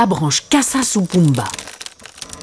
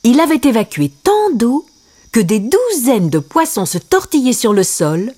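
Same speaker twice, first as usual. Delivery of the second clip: ordinary delivery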